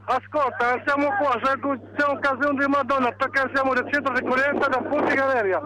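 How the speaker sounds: phone line call from a rooftop; tinny phone audio; over the phone. Real, not acted. Sì, ti sento.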